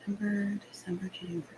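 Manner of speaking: soft whisper, counting the months to herself. Real, not acted. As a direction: whisper as if working it out quietly. Whispering while counting back months.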